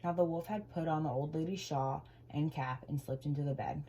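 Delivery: ordinary delivery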